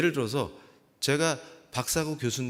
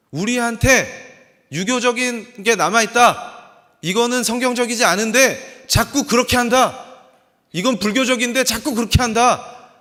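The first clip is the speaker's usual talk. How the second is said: loud, high, hammering exhortation; preaching at full force; 7 semitones higher, 10 dB louder than usual. Acted. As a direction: drive each charge home at full voice.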